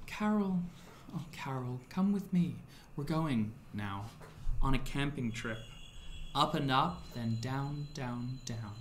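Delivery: put-on a smooth, beckoning voice drawing a child in; smooth luring woman. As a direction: coax softly, with lingering pauses. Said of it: "drawn in by this smooth voice".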